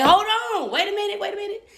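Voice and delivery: high, loud panicked "hold on"; alarmed cry. Retold or imitated. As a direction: yelp it as he slumps into you. Holding up a collapsing man.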